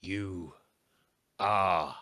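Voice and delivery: slow, looming villain voice; menacing echoing presence; unearthly voice. Acted. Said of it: "you hear an echoing voice".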